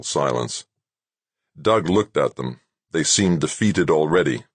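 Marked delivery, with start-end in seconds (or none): none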